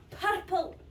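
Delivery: "Purple" with forceful rolled R's; welly behind the R's. Shown as a demonstration. Coaching the learner to roll R's, forceful Scottish "Purple". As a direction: drive the trilled R's in "Purple". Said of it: "Put some welly behind them".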